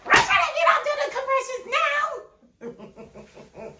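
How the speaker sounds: laughing high yell. Retold or imitated. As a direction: shriek at the screen in disbelief. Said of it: "I was like".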